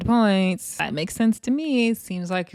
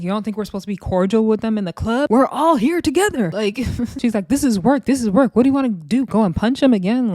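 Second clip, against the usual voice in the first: loud, heated and high, getting worked up; imitating a friend's angry outburst; angry retort; 3 semitones higher, 4 dB louder than usual. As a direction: snap back at her like she's out of line. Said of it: "that made Tavy Baby really angry".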